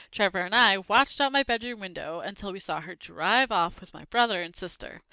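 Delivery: ordinary delivery, nothing marked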